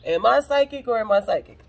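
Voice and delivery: loud, exultant shout through laughter; laughing triumph. Real, not acted. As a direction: crow it loudly, cracking up. Laughing boast about card matching.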